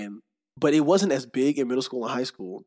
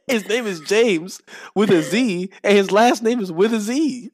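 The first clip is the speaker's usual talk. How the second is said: excited; 7 semitones higher, 8 dB louder than usual. Real, not acted. Excited retelling.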